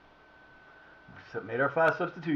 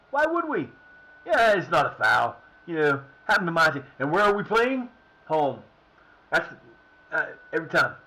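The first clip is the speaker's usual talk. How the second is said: 1 sarcastic complaint; 3 semitones higher, 6 dB louder than usual. Real, not acted. Sarcastic rant at referee.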